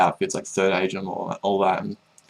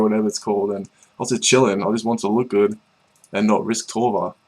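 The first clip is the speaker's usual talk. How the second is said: usual speaking voice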